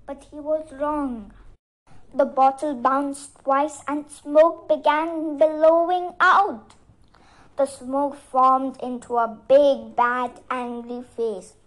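plain reading voice

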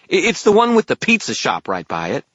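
high, loud, eager fairy; brightening fairy. A narrator's reading. Fairy suddenly remembers for wizard, eager high voice. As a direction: pipe up with a small stumble, pleased. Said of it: "before brightening".

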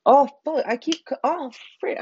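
startled cuss. Real, not acted. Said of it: "I keep cussing on".

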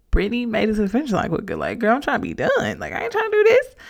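sassy self-quote. Retold or imitated. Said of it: "And I'm like".

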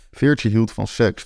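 ordinary delivery, nothing marked

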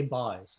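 "Buys." with an "eye" vowel, pitched low; Newfoundland "Buys.", low and falling; dialect "Buys". Shown as a demonstration. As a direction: say "Buys." to rhyme with "eyes", dropping the pitch. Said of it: "I say".

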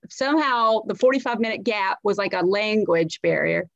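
usual speaking voice

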